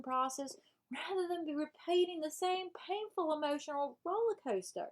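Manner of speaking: lively Southern self-help character; Southern accent. Pretend. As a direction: read it out in a put-on Southern accent. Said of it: "I'm reading this with a Southern accent".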